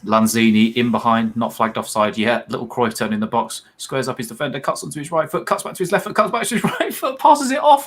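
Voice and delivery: football commentary climbing steadily to a high pitch; live play-by-play. Real, not acted. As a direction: build from steady description to a high goal call.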